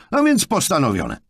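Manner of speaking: high, loud final word; curt decision. A narrator's reading. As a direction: cut off the argument curtly. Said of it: "uciął Jonathan". (ucinający ton decyzji)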